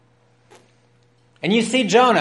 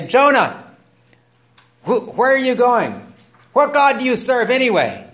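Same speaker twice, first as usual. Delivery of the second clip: questioning sailors; 7 semitones higher, 3 dB louder than usual. Acted.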